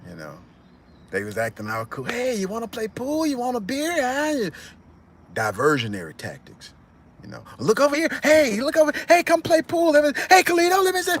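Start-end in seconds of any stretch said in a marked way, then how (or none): 2.0-4.5 much higher, sugary mock welcome; fake friendly. Thugs distracting Carlito, high fake friendliness. Gang members faking friendliness. 7.6-11.2 very high, louder coaxing calls; mock distraction. Thugs luring Carlito to the pool table, very high coaxing.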